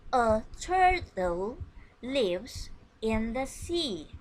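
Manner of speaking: slow and loud, every word set apart for the class; slow model sentence. Shown as a demonstration. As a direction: read it slowly so children can copy. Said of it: "Listen and repeat after me".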